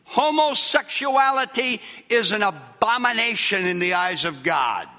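high, loud, slow pulpit declaration; full-force preaching. Acted. Pastor proclaiming his non-negotiable list to congregation, preaching loudly.

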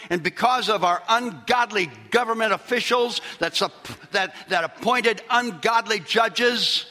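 high, loud sermon build; fiery preaching. Acted. Pastor denouncing the Supreme Court ruling, preaching hotly. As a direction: drive the point home to the flock.

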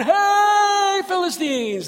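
long, high hollered hey; calling out. Acted. Jonathan at the bottom of the cliff, hollering up. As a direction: holler up a cliff, stretching the first word.

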